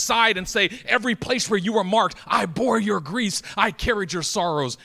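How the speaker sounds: loud, high, driving preacher cadence; relentless build, punching phrase after phrase; impassioned preaching. Real, not acted. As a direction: proclaim it with full fervor. Preaches at full force.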